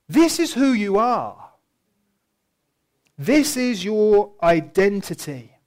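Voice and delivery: weighty, deliberate preaching; emphatic proclamation. Real, not acted. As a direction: drive each word home to the congregation. Preacher drives home his point.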